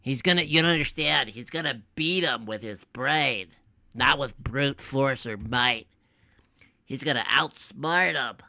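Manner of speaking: quiet sneering nerd voice; mocking nerd voice. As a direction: mumble it like a basement nerd. Mocking nerd hero, retold.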